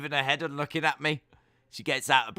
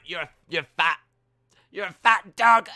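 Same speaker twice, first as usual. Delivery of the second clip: louder, higher mock crying shout; mock shout at mirror; 6 semitones higher, 13 dB louder than usual. Streamer shouting at himself in the mirror, mock crying. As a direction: yell it at yourself in the mirror, half crying. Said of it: "And I'm like".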